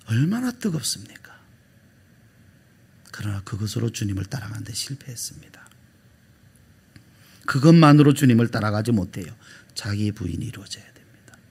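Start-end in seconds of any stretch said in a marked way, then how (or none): 3.1-5.7 hushed, breathy whisper to the congregation. Real, not acted. Preacher whispering Peter's failure to the congregation, hushed. 9.7-11.4 hushed, breathy whisper to the congregation. Real, not acted. Preacher whispering Peter's failure to the congregation, hushed.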